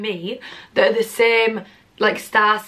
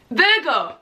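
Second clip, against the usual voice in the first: higher, triumphant "Virgo!"; excited exclamation; 5 semitones higher than usual. Real, not acted. Telling viewers she guessed right, proud. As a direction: punch it out proudly.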